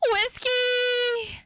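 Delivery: overjoyed call; joyful cry. Acted. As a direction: call the name in relief. Hugging recovered cat.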